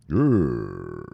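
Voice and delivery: low growl; grumbling animal growl at losses; mock growl. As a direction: growl like an annoyed dog.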